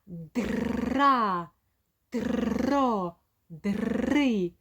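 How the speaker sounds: Дра, дро, дри each with a rolled р; syllables dra dro dri. Shown as a demonstration. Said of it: "ми так і починаємо". (склади Дра, дро, дри з розкотистим р)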